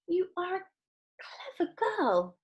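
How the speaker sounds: high, tearful proud mother; gushing mother. A narrator's reading. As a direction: coo over her daughter. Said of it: "said Missus Beauregard".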